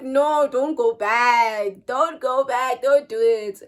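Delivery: slow, loud, insistent warning; loud, slow friend's plea; urgent pleading. Retold or imitated. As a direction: beg her firmly not to go back. Friend urging her not to return.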